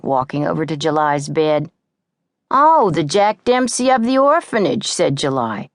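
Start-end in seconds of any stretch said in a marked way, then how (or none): 2.5-4.9 taunting. A narrator's reading. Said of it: "fight follows".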